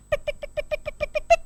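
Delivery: quick high tick tick of tiny feet; fast high ticking. Acted. Storyteller making the turtles' racing footsteps, high and quick. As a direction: tap it out high and fast, almost unvoiced.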